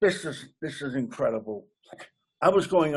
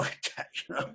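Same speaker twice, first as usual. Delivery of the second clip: usual speaking voice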